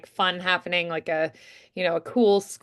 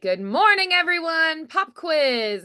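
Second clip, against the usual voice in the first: higher, sing-song cheery greeting; peppy teacher; 8 semitones higher than usual. Shown as a demonstration. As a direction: chirp it like a perky teacher. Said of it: "all peppy".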